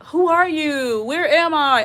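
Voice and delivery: high, lost and bewildered; confused elderly voice. Pretend. Imagining herself old, asking confused questions. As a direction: sound like you don't know where you are. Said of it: "I don't wanna, like".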